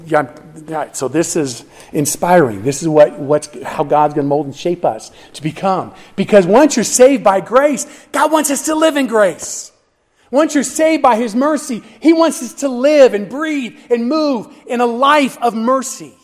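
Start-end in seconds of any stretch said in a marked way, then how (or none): 6.1-16.1 high, urgent pulpit proclamation; impassioned preaching. Real, not acted. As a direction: preach it fervently, pitched well above talk. Preaches at rising full force.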